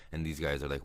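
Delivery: normal talking voice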